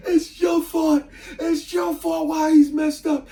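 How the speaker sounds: furious screaming mother. Retold or imitated. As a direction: scream the blame at your son. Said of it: "She was pissed off".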